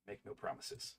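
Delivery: whisper. Acted. Repeats line in whisper.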